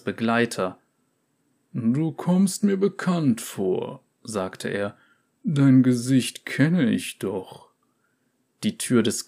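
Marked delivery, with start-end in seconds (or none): 1.7-4.0 slow, higher wary voice; drawn-out suspicion; suspicious merchant. Acted. Merchant recognizes companion. 5.4-7.7 drawn-out suspicion; suspicious merchant. Acted. Merchant recognizes companion.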